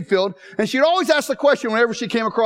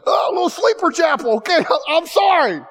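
mock sheepish; 8 semitones higher than usual.

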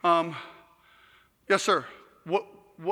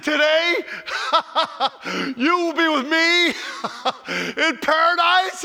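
higher and louder, words broken by laughs; laughing; 6 semitones higher, slower than usual. Retold or imitated. Jesus promising the thief paradise, laughing joyfully. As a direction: laugh through the promise with joy. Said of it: "He starts laughing out loud".

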